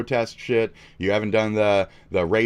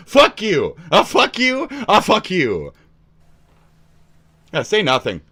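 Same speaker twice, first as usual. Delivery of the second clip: louder, higher furious curse; angry yell; 9 semitones higher, 7 dB louder than usual. Real, not acted. Cursing at Asmon after his reply, angry yell. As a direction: spit it out in rage.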